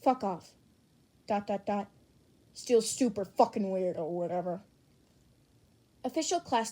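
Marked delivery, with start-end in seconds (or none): none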